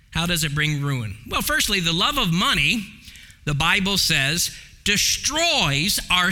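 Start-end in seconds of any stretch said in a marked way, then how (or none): none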